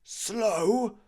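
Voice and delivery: high, loud, slow mournful spirit voice; wailing ghost. A narrator's reading. The ghost lamenting its fate to Scrooge, high and wailing. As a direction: moan it like a doomed soul.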